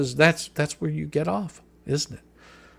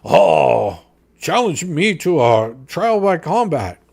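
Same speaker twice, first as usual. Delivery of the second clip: slow, loud, put-on taunting voice mimicking a challenger; mock-tough imitation of a challenger; mocking Paint Chip; 7 dB louder, slower than usual. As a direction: ham up a cocky dare, drawn out. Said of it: "Paint Chip was sitting there going".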